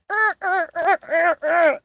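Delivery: high, loud bursts of growling; mad growling, imitated. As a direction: growl over and over in re-enacted rage. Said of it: "I get so mad".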